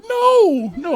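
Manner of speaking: very high loud grandma protest; high hammed-up grandma cry; shrill grandma no. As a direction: squeal no like a scandalised old lady. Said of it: "she's like".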